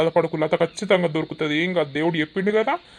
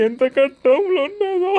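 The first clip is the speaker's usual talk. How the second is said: high wailing sob, much higher, voice cracking; sobbing; 11 semitones higher, 3 dB louder than usual. Acted. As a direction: weep aloud for the missing wife. Husband weeps for lost wife.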